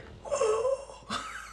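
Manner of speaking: high startled gasp; dog gasp. Retold or imitated. The dog reacts to mom's rage, high gasp. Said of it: "dog is like".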